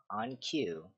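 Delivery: plain reading voice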